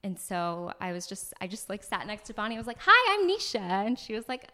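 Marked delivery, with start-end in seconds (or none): 2.8-3.6 high, loud, over-eager hello; eager greeting. Retold or imitated. Newcomer introducing herself to a stranger at a meetup, eager greeting. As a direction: blurt a big high-pitched introduction.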